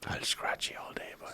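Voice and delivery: creepy whisper. Pretend. Creepy carer joke.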